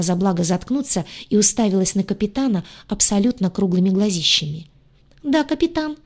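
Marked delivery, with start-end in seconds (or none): 5.2-6.0 high, timid squeak; frightened squeak. Acted. Redhead squeaks to the captain in fright. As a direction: answer small and scared. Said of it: "испуганно пискнула она".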